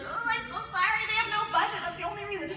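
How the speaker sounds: high, soft, squirming apology; apologetic inner voice. Retold or imitated. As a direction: plead silently in a small voice. Was just, like, inside.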